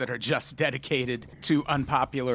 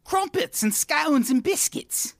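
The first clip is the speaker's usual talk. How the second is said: high, slow mock posh British voice; squeaky, slow hammed-up British accent; 12 semitones higher, slower than usual. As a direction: savour each British treat like a caricature. British bit continues.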